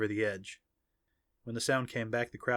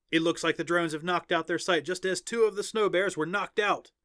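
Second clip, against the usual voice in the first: higher-pitched arena announcer; excited announcer; 7 semitones higher than usual. A narrator's reading. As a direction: call the match for the crowd. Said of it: "the announcer said".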